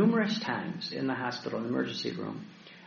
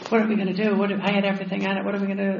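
mock panic, higher and hurried; panicked; 5 semitones higher, 5 dB louder, much faster than usual. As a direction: imitate frantic people in a raised, rushed voice. Retelling upset people who lost iPads.

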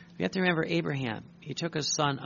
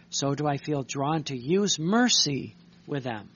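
forceful scripture reading; 4 dB louder than usual. Acted. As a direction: read the words of Jesus louder, as a stern proclamation. Reads Jesus' words in the diary.

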